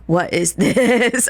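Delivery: slow, laughing amazement at a new song; hearing it for the first time, stunned and laughing; amazed. Retold or imitated. Child hearing a friend's song, amazed. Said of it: "I was gagged".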